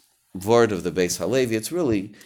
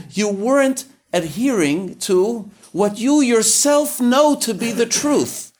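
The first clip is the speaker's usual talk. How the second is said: retold driver shouting, much higher and louder, slow on the first words; angry driver yelling; 12 semitones higher, 6 dB louder than usual. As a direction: play the scared driver yelling a scolding. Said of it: "and he yells at me".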